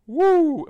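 high, loud "woo" at a stink; exclaimed woo. Acted. Preacher reacting to four days dead, loud high woo. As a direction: whoop as if hit by the smell.

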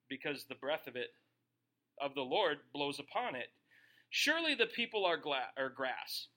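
brisk, declaiming Bible reading; steady pulpit reading of Isaiah; scripture reading. Acted. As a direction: read the passage out like a preacher. Reads scripture aloud.

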